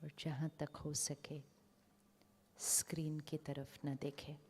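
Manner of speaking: very soft, unhurried meditation voice; guided meditation. Real, not acted. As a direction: settle them quietly, with long pauses.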